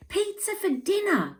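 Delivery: high, bright call across the room to Mum; a child reminding Mum as she leaves. Acted. Jessie reminds Mum at the door, calling out high. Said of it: "called Jessie".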